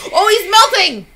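high and loud, delighted; excited yelp. Real, not acted. Player laughing at the melting sprite, high excited yelp. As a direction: yelp it out, thrilled.

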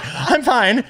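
high-pitched, put-on cheerfulness; high mock reassurance. Learner answering alarmed surf teacher, high mock reassurance. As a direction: squeak it out as if unharmed. Own reply to panicked teacher.

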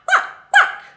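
high, loud quacks; dog faking quack. A narrator's reading. Dog tricking the thieves, high loud quacking. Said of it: "dog began to quack".

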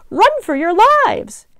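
very high, loud animal squeal; squealed panic. A narrator's reading. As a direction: squeal the alarm like frightened livestock. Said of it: "The animals squealed".

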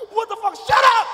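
explosive, high, very loud command; furious shout. Retold or imitated. Father silencing his daughter, furious shout. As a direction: cut them off with a yell.

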